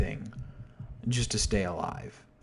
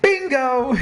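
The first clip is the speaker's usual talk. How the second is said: excited shout; 11 dB louder than usual. Real, not acted. Excited exclamation at own point.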